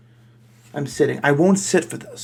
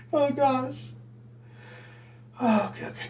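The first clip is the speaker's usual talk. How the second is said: composing herself; 10 semitones higher, 9 dB quieter than usual. Real, not acted. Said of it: "come on. You can do this".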